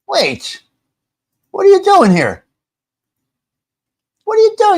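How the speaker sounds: sudden high startled questions; surprised. Real, not acted. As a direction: caught off guard, voice jumps up high. Someone unexpectedly appears on stream.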